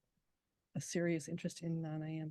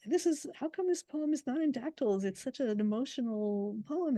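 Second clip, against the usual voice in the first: questioning student; 7 semitones higher, 4 dB louder than usual. Retold or imitated. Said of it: "people will be like".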